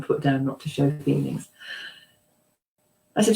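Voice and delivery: ordinary talk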